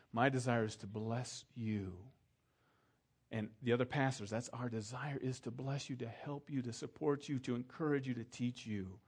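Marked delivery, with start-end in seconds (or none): none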